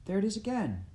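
pitched high with sudden excitement; urgent alert. A narrator's reading. Listener hears the noise outside, urgent alert. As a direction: alert everyone to the sound, voice jumping up. Hearing a shout in storm.

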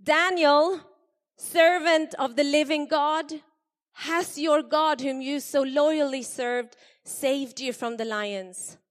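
high, loud worried calling out; anxious call. Acted. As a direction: call into the den, fearing the worst. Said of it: "he called out anxiously".

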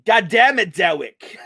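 "Dewick" for Derek, R turned into W. Acted. Co-host mock-cursing podcaster, "Dewick" said with W for R. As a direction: start high, then land on "Dewick" with a W. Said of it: "So you say R's are like W's?".

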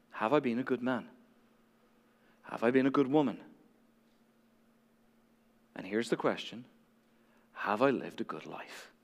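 low, hushed question to yourself; soft inner question. Acted. Preacher voicing a person asking themselves, low and hushed. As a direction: ask it quietly, as if into a mirror.

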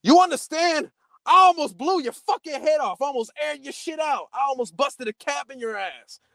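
imitating an irate cop, high-pitched tirade; retold angry threat. Cop tells the friend he almost shot him, angry. Cop's threat voiced in a story.

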